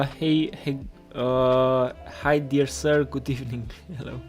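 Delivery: ordinary talk, nothing marked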